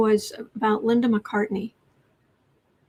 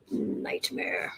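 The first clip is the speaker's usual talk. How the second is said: dramatic whisper; 10 dB quieter than usual. Acted. Reading viewer's question, dramatic end.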